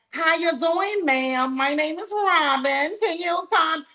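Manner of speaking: high, prim put-on salesy voice; fake proper voice. Mocking a fake polished table greeting. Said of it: "That is not me".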